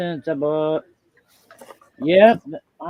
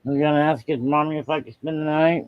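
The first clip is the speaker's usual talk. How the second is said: ordinary talk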